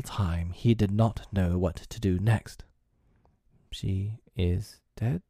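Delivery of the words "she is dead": slow, soft, uneasy question; uncomfortably. A narrator's reading. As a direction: ask it unsure and awkward. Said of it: "he said uncomfortably".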